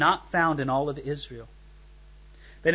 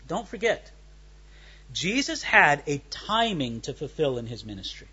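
ordinary talk, nothing marked

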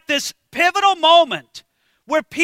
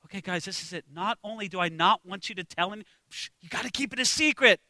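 hushed secret; 6 semitones lower, 9 dB quieter, faster than usual. Retold or imitated. Jesus hushes the disciples.

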